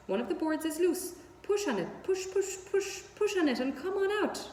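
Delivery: an excited goose egging him on, push push push; honking goose. Acted. Goose urging Wilbur to push the board, high squawking voice. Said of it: "who talked rather fast".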